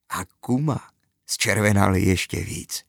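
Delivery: ordinary delivery, nothing marked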